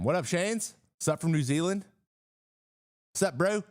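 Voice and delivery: high-pitched mock New Zealand accent; attempted New Zealand accent. Streamer greeting a viewer from New Zealand, mock Kiwi accent. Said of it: "I try to do an accent".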